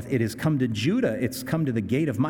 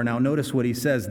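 ordinary delivery

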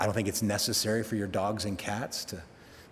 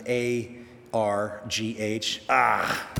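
comic-strip groan; 2 semitones lower, much slower than usual. Shown as a demonstration. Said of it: "as you read in comics".

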